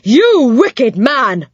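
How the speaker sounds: high, slow, loud angry snap; an old dame's fierce rebuke; snapping. A narrator's reading. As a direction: scold him sharply, word by word. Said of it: "she snapped at Moonface".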